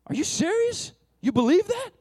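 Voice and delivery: hammed-up amazed friend; dramatic surprise. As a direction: gush like he can't believe it. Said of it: "he is that dramatic".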